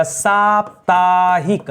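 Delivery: साप्ताहिक stretched slowly, syllable by syllable; slow careful साप्ताहिक with a falling end; slow drawn-out word. Shown as a demonstration. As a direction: draw out साप्ताहिक very slowly, teacher-style. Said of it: "स के जगह सा हो जाएगा".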